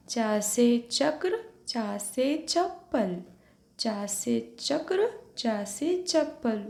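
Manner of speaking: each word drawn out slowly for the class to repeat; slow model reading. Shown as a demonstration. Teacher to young pupils, slow clear model reading. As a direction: model it slowly so students echo. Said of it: "Repeat after me".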